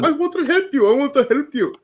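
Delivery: a hint of a Middle Eastern accent; lightly mock the accent. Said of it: "a Middle Eastern accent".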